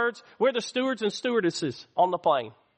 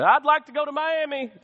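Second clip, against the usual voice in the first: higher voice piping up from the cabin; hammed-up passenger, pitched up; mock eager passenger; 6 semitones higher than usual. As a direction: call out a request like a passenger. Acted passenger interrupting the announcement.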